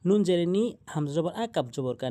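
usual speaking voice

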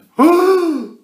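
dramatic shocked gasp; loud high gasp. Acted. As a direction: gasp in horror. Audience sees it.

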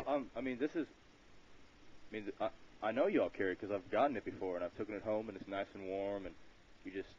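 ordinary talk, nothing marked

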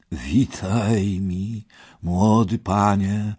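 put-on slow, drawn-out elderly greeting; old knight. Old knight greets young Parsival, slowly. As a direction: welcome the youth at a leisurely pace. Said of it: "rzekł stary rycerz, uśmiechając".

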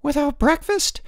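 high-pitched, indignant woman; shocked exclamation. A narrator's reading. Headmaster's wife learns boys went without breakfast, shocked. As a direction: exclaim it, voice leaping up.

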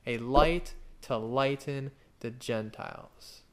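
slowly savoured phrase; "light" stretched and stressed; slow emphasis. Shown as a demonstration. Preacher repeating the phrase slowly to the church. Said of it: "Remember that passage?".

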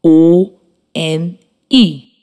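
letters called one by one, spaced out; spelling aloud. Shown as a demonstration. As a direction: spell each letter clearly for dictation. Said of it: "it is spelled".

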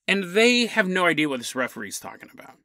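ordinary delivery

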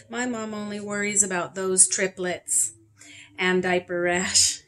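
ordinary delivery, nothing marked

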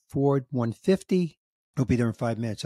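normal talking voice

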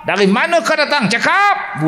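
mock a rough healer demanding an answer; mock harsh healer shouting at a patient. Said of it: "perawat-perawat ni kasar".

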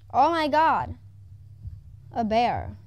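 loud startled cry at a sudden bear; sudden loud oh my God; startled shout. Real, not acted. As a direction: yelp as the bear appears. Startled by player appearing.

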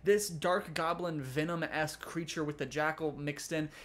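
ordinary delivery

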